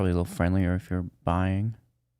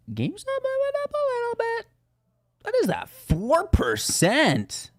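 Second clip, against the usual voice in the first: mock excitement. Mock excitement over small gain.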